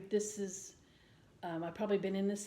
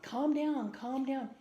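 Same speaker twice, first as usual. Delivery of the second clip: mock pushy higher louder calm down; 5 semitones higher, 4 dB louder, slower than usual. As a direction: parrot the annoying advice, pushy and raised. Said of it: "when they're all like".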